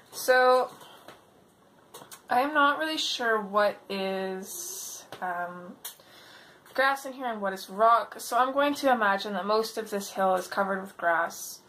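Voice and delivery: ordinary delivery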